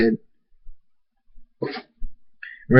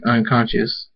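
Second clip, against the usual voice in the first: usual speaking voice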